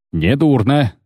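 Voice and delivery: usual speaking voice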